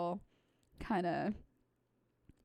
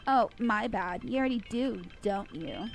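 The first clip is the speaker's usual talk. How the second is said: snide, loud little taunt; mocking bratty girl; 7 dB louder than usual. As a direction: sneer the comeback at her.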